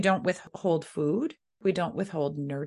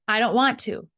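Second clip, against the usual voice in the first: put-on loud, high kid refusal; higher-pitched acted defiance; defiant child; 5 semitones higher, 6 dB louder than usual. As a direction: protest like a stubborn child.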